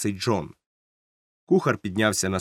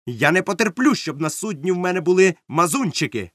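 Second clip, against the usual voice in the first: raised, higher-pitched indignant voice; loud declaration; 6 semitones higher, 3 dB louder than usual. A narrator's reading. Captain tells the doctor about favourites, loudly. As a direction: declare it so everyone overhears. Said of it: "голосно сказав докторові".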